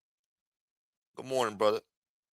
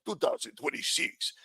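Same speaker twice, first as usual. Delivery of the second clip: mocking his video; 4 semitones higher than usual. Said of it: "with his fucking stupid hand waving".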